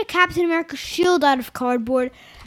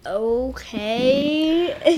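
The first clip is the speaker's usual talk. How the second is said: weirded-out reaction; 3 semitones lower than usual. Acted. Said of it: "it makes me like".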